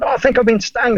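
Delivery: put-on high, whiny kid voice; imitating a stung kid. Boy tells his mum about the sting, high and whiny. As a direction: play the hurt little boy, high. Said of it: "I said".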